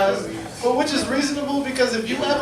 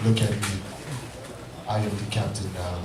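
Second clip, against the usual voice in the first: much lower, deep and menacing; captain movie line; 10 dB quieter than usual. Retold or imitated. Husband answers wife about bills, deep and menacing. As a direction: drop way down, dead serious threat. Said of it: "I was like".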